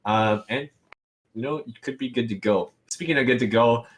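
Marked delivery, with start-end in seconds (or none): none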